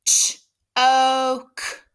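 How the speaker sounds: Ch-oke split into ch and oke, loud; sounding out. Shown as a demonstration. Teacher sounding out choke for spelling students, loud. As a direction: tap out the two parts of Ch-oke. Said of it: "let's tap the sounds".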